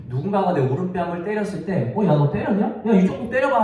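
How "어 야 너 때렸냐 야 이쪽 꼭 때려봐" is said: higher-pitched, playful goading; mock taunting. Slapped person daring the attacker to hit the other cheek, taunting. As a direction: tease the attacker into another slap. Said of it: "놀리라는 뜻이 아니에요".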